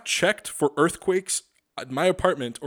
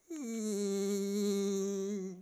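long steady high droning hum; mock AC unit drone; air conditioner hum impression; 6 semitones higher, 7 dB quieter than usual. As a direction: imitate a humming AC unit. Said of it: "That was my A C, uh, impersonation".

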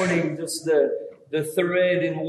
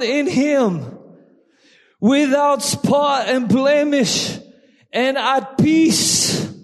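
raised, full-voiced sermon delivery; preaching; 8 semitones higher, 5 dB louder than usual. Real, not acted. Pastor exhorts congregation about waiting, preaching loudly. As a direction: preach it, pressing each phrase. Preaching Second Peter passage.

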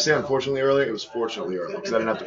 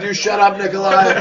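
put-on kid yelling at TV; 10 semitones higher, 9 dB louder than usual. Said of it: "And I said".

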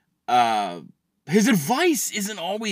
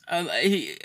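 ordinary delivery

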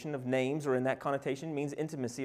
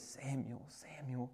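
hushed call; 11 dB quieter, slower than usual. Acted. Voice of God calling softly.

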